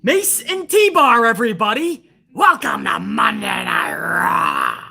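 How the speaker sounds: loud, drawn-out ring announcer shout; hype announcer. Pretend. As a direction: belt it out like a big arena intro. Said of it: "No, that's not how they introduced us".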